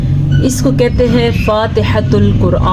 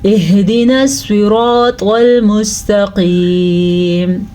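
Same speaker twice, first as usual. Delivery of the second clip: slow, drawn-out melodic tajweed recitation; Quran recitation; much slower than usual. Shown as a demonstration. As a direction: chant each verse slowly with long held vowels. Full tajweed recitation of Fatiha. (سست، لمبی آواز میں تجوید کے ساتھ تلاوت)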